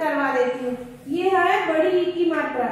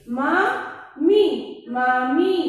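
मामी drawn out slowly, then मामी said quickly; slow then normal; 3 semitones lower, much slower than usual. Shown as a demonstration. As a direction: stretch मामी, then say it plainly. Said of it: "इसके हम शब्द भी बता देते हैं".

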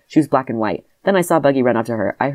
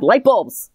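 LIGHT BULBS punched with mock excitement; exclamation mark; 7 semitones higher than usual. A narrator's reading. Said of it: "Exclamation mark".

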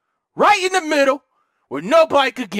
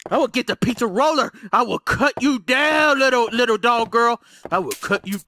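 ordinary delivery

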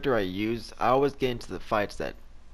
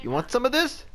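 taunting; 6 dB louder than usual. Real, not acted. Taunting an enemy.

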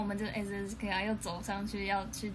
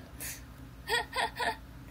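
a sudden squeaky burst of laughter; high breathless laugh; 12 semitones higher than usual. Real, not acted. Laughing at being cut off mid-story. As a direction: break into helpless giggles.

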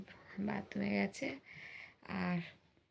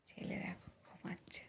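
hushed whisper; 10 dB quieter than usual. Real, not acted. Son asleep, mother hushes. (ঘুমন্ত ছেলের পাশে ফিসফিস করে)

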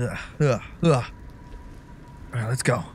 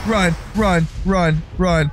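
loud, higher, punched repeated command; panicked urging; 5 semitones higher, 5 dB louder, slower than usual. Real, not acted. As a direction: urge escape in short loud bursts. Fleeing after jump scare.

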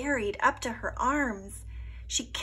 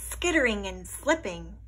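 usual speaking voice

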